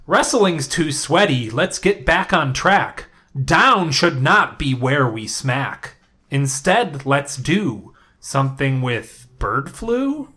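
slow, sing-song limerick recital; slow limerick reading. Shown as a demonstration. Host reads next week's assignment as a slow limerick. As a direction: read it slowly like a poem. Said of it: "in the form of a limerick".

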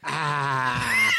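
imitated kids' "Ah", no R; "Ah" drawn out, copying the teacher. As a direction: echo "Ah" as a long open vowel. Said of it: "they were just making her noise".

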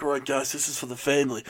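low, soft movie tough-guy impression; Vin Diesel. Co-host imitating an action star to his crew, low and soft. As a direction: keep it low and quiet, like an action lead. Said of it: "Vin Diesel being like".

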